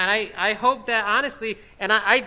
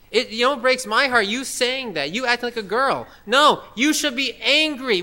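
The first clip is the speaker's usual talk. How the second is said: ordinary delivery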